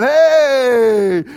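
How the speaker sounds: loud, high, drawn-out holler; full cheer. Retold or imitated. Fourteen kids and three adults cheering, full cheer. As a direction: shout it across the stands.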